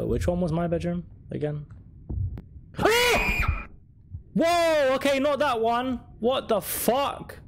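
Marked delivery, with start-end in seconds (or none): 2.1-3.6 sharp high gasp of fright; startled gasp. Real, not acted. Jump scare in horror game. 4.3-6.0 high loud startled yelp. Real, not acted. Jump scare in horror game. 6.2-7.4 shocked. Real, not acted. Jump scare in horror game.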